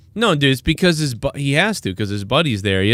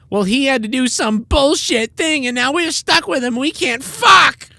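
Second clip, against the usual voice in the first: high, squeaky put-on whine building to a shout; mocking Tommy complaining about the kids; angry stepdad impression; 13 semitones higher than usual.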